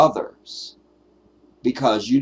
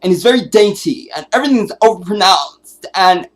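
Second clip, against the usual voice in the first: much higher, louder mocking dainty voice; dainty overpronounced son; 12 semitones higher, 11 dB louder than usual. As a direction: ham up a prissy overpronouncing son. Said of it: "his son sounds like this".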